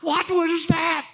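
very high, loud, slow laughing cry to God; mock startled plea to the Lord; shaken, laughing. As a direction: cry out half-laughing, just woken from a nightmare. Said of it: "I go".

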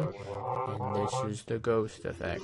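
slow, eerie ghost filter on the voice. Shown as a demonstration. Reviewer demos the ghost preset, slow ghost voice. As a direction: drag the words out through the ghost effect. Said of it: "this ghost voice".

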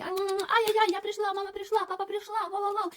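very high, squealing over-the-top cooing; gushing baby talk. Shown as a demonstration. Owner coming home, cooing high and squealy at the excited puppy. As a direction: gush at the dog like a doting owner. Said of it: "стараєтесь її не балувати, ні".

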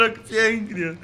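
normal talking voice

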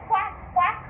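high-pitched quack, quack; like a duck. Shown as a demonstration. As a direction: quack it brightly for children to copy. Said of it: "like a duck".

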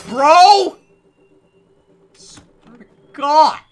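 loud, high, dragged-out shout; fed-up shout, drawn out; exasperated yell. Real, not acted. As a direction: bellow at the idiot. Yelling at NPC.

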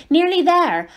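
out of breath, high and loud; panting. Acted. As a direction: pant it from the climb. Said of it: "Harry panted".

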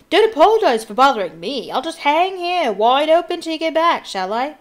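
loud, high, put-on voice of indignation; irritable calling. A narrator's reading. Fat Lady left hanging wide open, irritable calling. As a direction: complain loudly after someone walking off. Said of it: "called irritably after them".

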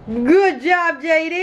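high-pitched cheering praise; excited praise. Real, not acted. Mother praising her toddler's chore, high excited cheer.

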